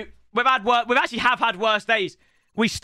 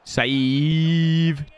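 ordinary delivery

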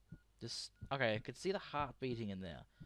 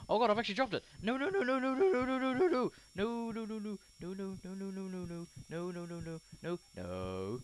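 high frantic string of no's, fading; panicked pleading; 9 semitones higher, 6 dB louder than usual. Real, not acted. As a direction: beg desperately, then trail off. Dropped the organ in game.

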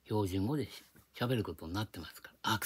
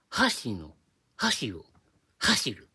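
Kansai accent; 5 dB louder, much slower than usual. Shown as a demonstration. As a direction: hit each word's first sound in Kansai accent. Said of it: "これ関西弁でやると".

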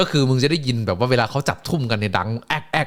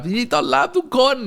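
announcer welcome; 13 semitones higher than usual. Retold or imitated. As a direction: draw out the welcome like an arena host.

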